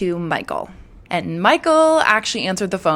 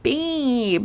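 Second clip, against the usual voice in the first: annoying whine; 6 semitones higher, 4 dB quieter than usual. Shown as a demonstration. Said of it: "being annoying on purpose".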